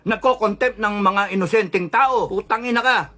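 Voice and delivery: worked-up angry rant; angry tirade. Real, not acted. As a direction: berate them with indignant curses. Cursing out the president directly.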